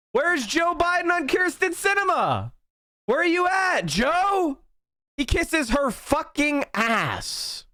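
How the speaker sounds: high yelling, slowing on the last line; angry shout. Real, not acted. Streamer demanding where Biden is, high angry shouting. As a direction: yell the question at an absent Joe. Raging at Biden over Sinema.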